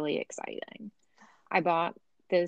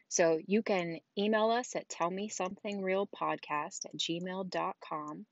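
ordinary delivery

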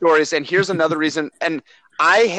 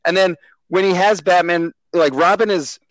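normal talking voice

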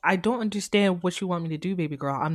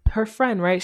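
normal talking voice

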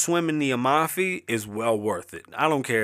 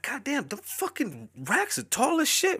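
high-pitched mock voice, softer than his own; amazed muttering; 12 semitones higher, 3 dB quieter than usual. Gawking at the tall Walmart racks, high squeaky mock voice. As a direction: squeak it out in a much higher voice, amazed. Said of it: "I'm looking like".

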